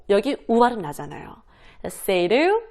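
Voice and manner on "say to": "say to" run together as "say-ru"; flapped T in say to. Shown as a demonstration. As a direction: soften the t of "say to" to a tapped r. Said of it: "이런 식으로".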